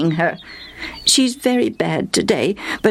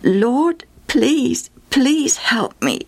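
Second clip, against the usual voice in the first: higher, drawn-out entreaty; pleading; 8 semitones higher, much slower than usual. A narrator's reading. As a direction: beg him, stretching the last plea. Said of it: "she pleaded".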